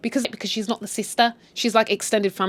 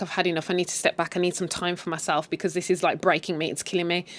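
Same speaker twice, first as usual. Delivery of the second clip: soft, subdued confession; acted weariness; 3 semitones lower, 6 dB quieter than usual. Joyce is talking to her mum, acted quiet weariness. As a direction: play someone worn down, quietly admitting it.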